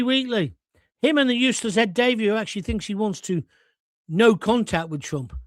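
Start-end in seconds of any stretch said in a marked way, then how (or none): none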